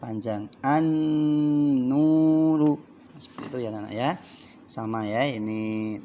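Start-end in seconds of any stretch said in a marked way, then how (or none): 0.7-2.8 an-nuru, nn ditahan sangat pelan; held gunnah. Shown as a demonstration. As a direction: tahan nn di an-nuru dua ketukan. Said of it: "harus dibaca panjang". (an-nuru dengan dengung panjang)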